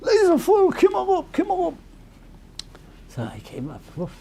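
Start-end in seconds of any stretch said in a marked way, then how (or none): 0.0-1.8 high calling voice of the Rebbe; high-pitched summons. Retold or imitated. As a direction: call him up to the front.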